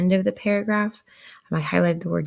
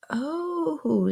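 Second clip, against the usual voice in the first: put-on child's realisation; 11 semitones higher than usual. A narrator's reading. Pip reacts to answer.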